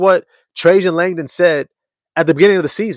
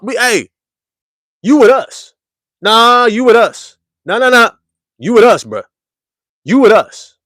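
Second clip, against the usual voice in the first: louder and higher, hammering the claim; hyped fan claiming the coach; emphatic insistence; 7 semitones higher, 6 dB louder than usual. Real, not acted. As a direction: insist he belongs to us.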